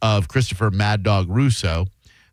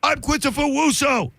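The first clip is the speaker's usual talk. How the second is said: much higher, distorted Mad Dog voice; Christopher Russo impression. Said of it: "cleft palate to where he'd go".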